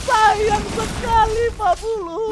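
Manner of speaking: high, loud shouted casting; hyped play-by-play. Real, not acted. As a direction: scream the big play. Live esports play-by-play.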